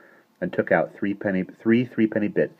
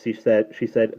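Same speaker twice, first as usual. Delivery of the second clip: plain reading voice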